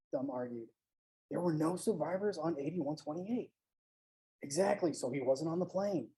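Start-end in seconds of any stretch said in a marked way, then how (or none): 1.3-6.1 hushed, much softer; a hushed urgent aside between two men; under the breath. A narrator's reading. Dee muttering to his companion, hushed and urgent. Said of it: "bickering under their breath".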